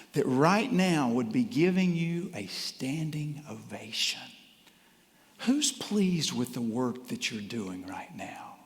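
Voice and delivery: hushed, slow whisper. Real, not acted. Speaker asks listeners who applauds them, in a slow whisper. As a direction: draw them in with a secret whisper. Intimate prompt to the audience.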